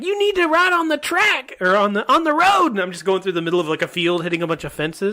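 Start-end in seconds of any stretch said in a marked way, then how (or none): 0.0-2.7 high mock voice. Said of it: "passenger seat's like".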